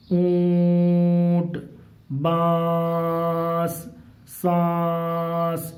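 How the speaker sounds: اونٹ, بانس, سانس with the nasal vowel held very long on one note; nasal nun ghunna. Shown as a demonstration. Teacher shows pupils nun ghunna, long nasal hum in اونٹ، بانس، سانس. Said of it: "ہمارے ناک میں کس طرح سے جا رہی ہے".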